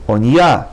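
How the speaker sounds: "oniya" with a quick short o; oniya, brief o sound. Shown as a demonstration. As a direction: clip the o in "oniya". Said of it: "the o sound is a little shorter".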